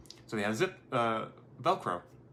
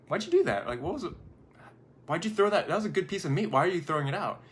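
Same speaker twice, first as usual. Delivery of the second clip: lightly voiced puzzled husband, pitched up; a touch of higher-pitched protest, acted; mildly baffled husband; 6 semitones higher, faster than usual. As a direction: question it in mild disbelief, voice lifting. Husband challenges wife in retold story.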